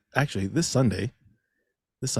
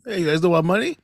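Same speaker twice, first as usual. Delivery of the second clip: mock impatient customer; 8 semitones higher, 6 dB louder than usual. Mocking customer at speaker.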